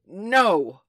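loud, emphatic refusal; big loud answer in a guessing game; ringing denial. A narrator's reading. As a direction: ring it out proudly.

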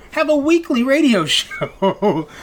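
high, drawn-out line breaking into laughter; laughing punchline. Real, not acted. Busy podcast host revealing the absurd new plan, laughing.

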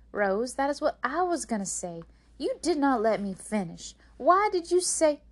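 loud scolding, rising high at the end; indignant complaint. A narrator's reading. May May scolds Rose for interrupting, indignant. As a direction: protest hotly at being cut off.

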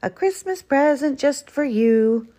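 jolly gift-giving lilt; merry. A narrator's reading. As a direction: present it merrily. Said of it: "said Badger merrily".

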